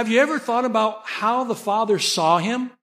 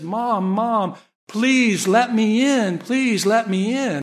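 higher, drawn-out begging; pleading son; 3 semitones higher, 3 dB louder, slower than usual. Retold or imitated. Son begging his mother to let him in, pleading. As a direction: imitate a child working on his mother. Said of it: "when he's asking for something".

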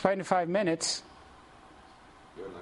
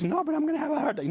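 put-on high, fast frightened old woman; mock-panicked old lady, much higher and quicker; panicky old lady; 11 semitones higher, much faster than usual. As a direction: squeak it out like a panicking elderly lady. Voicing panicked elderly lady.

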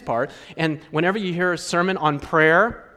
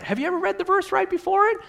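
high, fast pressing question; high, quick challenge to the congregation; urgent challenge; 14 semitones higher, much faster than usual. Real, not acted. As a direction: push them to look closer.

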